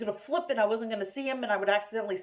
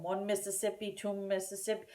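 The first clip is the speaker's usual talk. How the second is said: much softer, steady count; counting seconds; 8 dB quieter than usual. Shown as a demonstration. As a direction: count the seconds softly and evenly.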